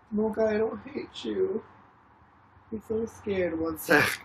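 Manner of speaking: ordinary talk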